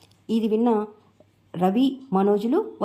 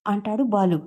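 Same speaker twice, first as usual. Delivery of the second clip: ordinary delivery, nothing marked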